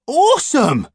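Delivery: very high, loud thrilled shriek. A narrator's reading. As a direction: squeal it with delight. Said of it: "he shrieked".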